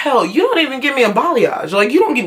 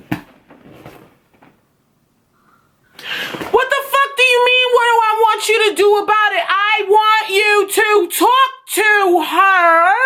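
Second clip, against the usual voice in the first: outraged; 10 semitones higher than usual. Retold or imitated. Student answering unhelpful teacher, outraged and shouting at the end. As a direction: throw the question back in high-pitched outrage.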